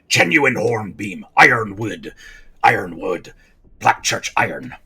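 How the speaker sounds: slow pirate voice, words set apart; old pirate hare. Acted. Old hare pirate showing off the brooch, slow pirate voice. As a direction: savour each name like an old pirate. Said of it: "this old hare is a pirate".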